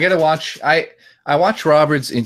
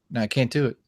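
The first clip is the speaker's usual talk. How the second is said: ordinary talk, nothing marked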